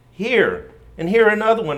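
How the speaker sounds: mild wail, a touch of distress. A narrator's reading. Corrie to Betsy, a mild reading wail. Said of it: "I wailed".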